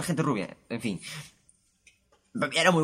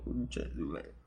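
low, much softer inner curse; grumbled to yourself, far quieter; muttered curse; 9 semitones lower, 11 dB quieter than usual. Retold or imitated. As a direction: curse under your breath, inside. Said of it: "En mi ático, así como".

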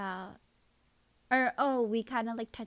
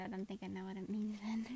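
whisper; 3 semitones lower, 7 dB quieter, faster than usual. Real, not acted. Embarrassed aside, unvoiced.

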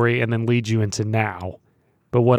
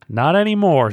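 higher, louder officer's retort; hoarse officer shouting orders; 6 semitones higher, 5 dB louder than usual. Acted. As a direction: bark it like an officer. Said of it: "said the lieutenant".

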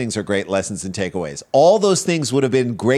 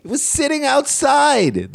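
high pitch; slower than usual. Acted. Mock dramatic reading.

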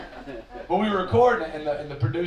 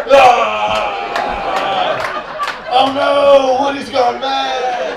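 much louder, higher, unhinged cowboy-toy yell; pissed off Woody; 8 semitones higher, 9 dB louder, much slower than usual. Retold or imitated. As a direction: rage like a drunk, furious Woody from Toy Story. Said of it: "a pissed off Woody from Toy Story".

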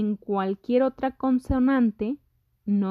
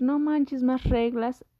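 mock student complaint; 4 semitones higher, slower than usual. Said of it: "Sé que ahorita van a decir".

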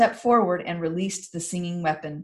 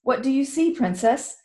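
higher, firm question; commander's question; 7 semitones higher, 3 dB louder than usual. Acted. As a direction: commander asking the princess.

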